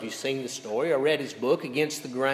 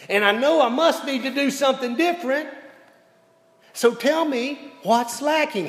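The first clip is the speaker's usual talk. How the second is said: rising, louder and higher, pressing for an answer; frustrated demand; 9 semitones higher, 5 dB louder than usual. Retold or imitated. Coach pressing his captain, frustrated and louder. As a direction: push harder, a coach out of patience. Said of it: "Bill got very frustrated with this".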